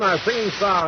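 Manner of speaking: ordinary talk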